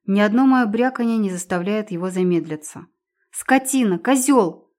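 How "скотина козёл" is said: furious name-calling shout; angry shout. Acted. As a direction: spit the insults out loud. Heroine insults captor.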